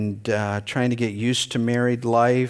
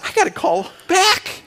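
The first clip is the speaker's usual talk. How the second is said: much higher, laughing through the words; laughing realization. Retold or imitated. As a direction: laugh while you blurt it out. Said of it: "And then I realized".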